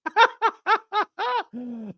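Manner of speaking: a high burst of embarrassed laughter. Real, not acted. Laughs at the broken stream.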